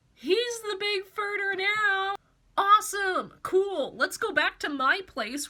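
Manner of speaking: high, loud excited put-on voices; hammed-up excited followers; mock crowd cheer. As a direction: hype up the new ruler. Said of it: "they all go".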